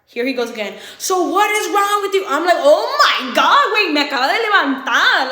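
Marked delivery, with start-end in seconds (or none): 1.0-2.2 higher, louder retold demand; mildly hammed-up accusation; mock confrontation. Acted boyfriend starting a quarrel. 2.6-5.3 hammed-up fed-up complaint; retold exasperation. Acted exasperated reply on waking.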